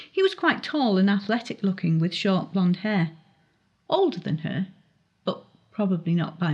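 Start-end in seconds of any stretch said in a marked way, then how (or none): none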